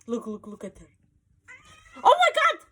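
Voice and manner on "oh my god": much higher, louder startled yelp; startled cry. Real, not acted. Startled when her cat lashes out, high yelp. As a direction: jump at the cat's swipe. Cat suddenly turns aggressive.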